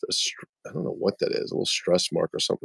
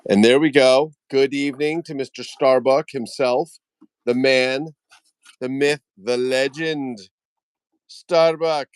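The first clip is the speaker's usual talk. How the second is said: raised, announcer-like introduction; grand welcome; 6 semitones higher, 4 dB louder than usual. Pretend. Host welcomes a fellow customizer, mock-grand introduction. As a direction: hail him like a legend, voice lifted. Hyping a viewer's arrival.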